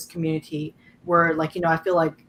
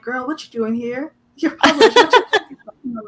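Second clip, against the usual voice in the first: much higher and louder, teasing disbelief; Jason Reynolds teasing; 8 semitones higher, 9 dB louder than usual. Retold or imitated. Publishing brother teasing her at Hamlin, loud playful disbelief. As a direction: call out a friend, playfully shocked.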